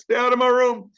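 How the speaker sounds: high, loud shout at intruders; sudden hammed-up teacher outburst; mock yell. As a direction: holler it high to chase someone off. Mock-yells at door interrupters.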